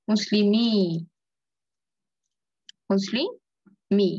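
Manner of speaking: মুসলিমি held on "mii", then split slowly; ya kept. Shown as a demonstration. Teacher models the remaining ya, long i. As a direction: punch out মুসলিমি with a long open i. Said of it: "ইয়াটা".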